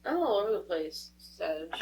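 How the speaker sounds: ordinary talk, nothing marked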